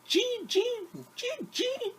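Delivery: very high squeaky mouse voice; mouse squeak. Acted. Storyteller squeaks as the gray mouse.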